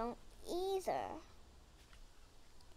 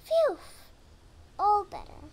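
relieved; 6 semitones higher, 8 dB louder than usual. Acted. Relief after brushing.

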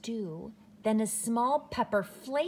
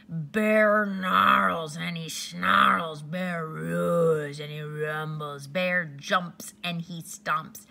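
loud slow snarling narration; growling bear; 2 semitones lower, 7 dB louder, slower than usual. A narrator's reading. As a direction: roar it out like a grumpy bear. Angry bear waking up.

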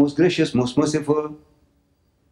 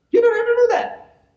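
mocking high voice. Mocking easy salvation.

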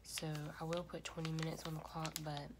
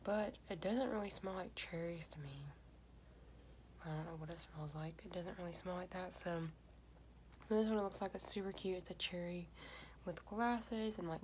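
talking with face mask on; speaking under wet face sheet; wearing a sheet mask. Real, not acted. Seen 0: Red cherry sheet mask covers whole face; mouth opening left uncovered.

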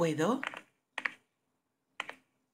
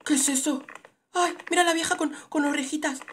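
excited squeal; 11 semitones higher, 8 dB louder than usual. Real, not acted. Spots odd character.

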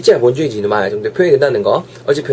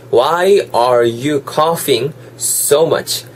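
very slow, word by word; very slow model sentence; much slower than usual. Shown as a demonstration. Teacher closing the demo with a very slow model sentence. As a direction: stretch every word out for learners. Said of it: "잘 들어보시면요".

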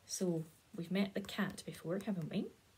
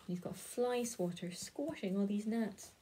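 usual speaking voice

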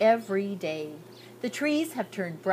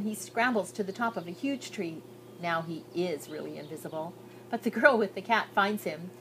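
usual speaking voice

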